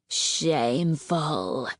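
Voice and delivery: a low, slow, contemptuous snarl. Acted. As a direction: growl the word out with disgust. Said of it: "Battle Winner snarled".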